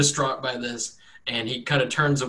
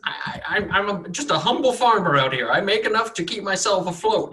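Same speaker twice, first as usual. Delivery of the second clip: put-on higher stammering old farmer; higher farmer voice, nervous and humble; 8 semitones higher, 3 dB louder than usual. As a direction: stumble over words, eager to please.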